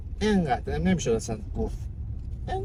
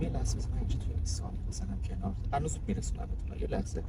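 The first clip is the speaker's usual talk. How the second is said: quick whispered aside off the mic; fast low whisper aside; hushed whisper; 5 semitones lower, 7 dB quieter than usual. Real, not acted. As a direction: whisper it fast, as if no one should hear. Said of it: "صدا چیه خودت در میاری؟".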